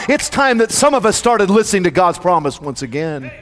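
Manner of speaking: ordinary talk, nothing marked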